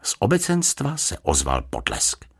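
usual speaking voice

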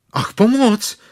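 high, loud call for help; cry for help. Acted. Stuck bear calls for help, high alarmed cry. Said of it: "zvolal Pů".

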